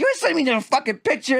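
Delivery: high-pitched hammed-up scolding; mock angry retort. Wife rejecting his excuse, mock high-pitched anger. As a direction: fire back in a high, put-on voice.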